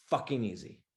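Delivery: usual speaking voice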